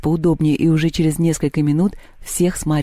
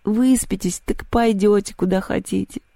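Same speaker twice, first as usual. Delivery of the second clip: higher, drowsy murmured advice; sleepy; 4 semitones higher than usual. Acted. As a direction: send them to bed while dozing off. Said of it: "сонно спросила Люба".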